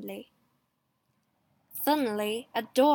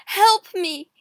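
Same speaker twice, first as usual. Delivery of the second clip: loud, high cry for help; screamed plea; 7 semitones higher, 10 dB louder than usual. A narrator's reading. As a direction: cry out for rescue. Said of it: "he screamed".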